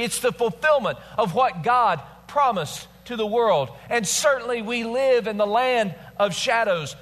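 high, emphatic pulpit delivery; impassioned preaching. Real, not acted. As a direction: drive each phrase home to the congregation. Preaches the main point.

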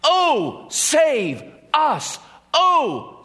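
high, desperate pleading cry; crowd crying out. Acted. A preacher voicing the crowd begging for help, pleading cry. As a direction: cry out for rescue, voice pitched high. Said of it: "they were crying out".

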